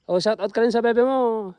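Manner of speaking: ordinary delivery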